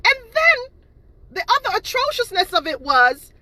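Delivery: outraged. Real, not acted. Ranting at haters' lies.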